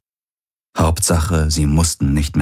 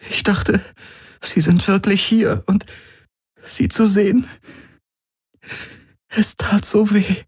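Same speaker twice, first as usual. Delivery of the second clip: much higher, fighting back tears; tearful. A narrator's reading. Kirai tells Galen through tears that seeing her was painful, tearful and much higher. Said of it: "gegen ihre Tränen anzukämpfen".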